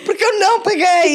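high, loud, laughing through words. Real, not acted. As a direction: laugh while insisting. Laughing about not paying.

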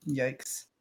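usual speaking voice

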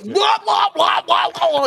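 high, loud gibberish yelling; hammed-up blah blah shout; mock screaming. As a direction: yell nonsense like a wild wrestler. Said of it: "screaming and yelling".

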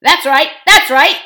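rowdy dwarf heckler; growling dwarf. A narrator's reading. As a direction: growl agreement like a riled dwarf. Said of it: "growled another dwarf".

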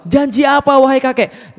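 high-pitched, incredulous challenge; exasperated questioning. Acted. Younger man asking why grandpa insists, exasperated and high. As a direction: question the stubborn elder, pitch well up.

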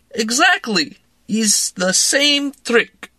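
tipsy Russian woman urging a drink; Russian accent. A narrator's reading. Woman pressing vodka on narrator, in a Russian accent. Larissa voice.

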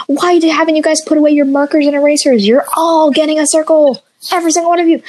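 snapping at the whole class; mildly mocked teacher scolding.

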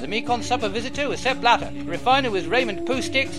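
loud, brisk credits announcer. Acted. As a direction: read the credits like a radio announcer. Spoof credits announcer read.

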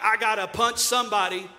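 hammed-up furious God, loud; preacher mocking an angry God. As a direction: play God itching for a fight. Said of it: "God being like".

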